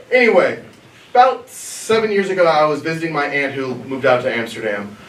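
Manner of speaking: normal talking voice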